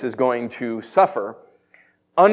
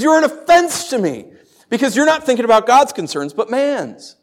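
high, raised-voice rebuke; yelling back; 8 semitones higher, 3 dB louder than usual. Acted. Jesus yelling back at Peter, high and stern. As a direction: throw the accusation back, pitched high. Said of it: "Yells back".

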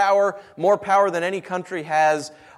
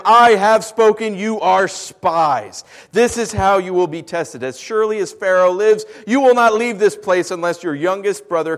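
harsh decree; 7 dB louder than usual. Acted. Joseph sentences his brothers to prison, loud and commanding. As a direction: lay down the law like a stern governor. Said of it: "spoke harshly to them".

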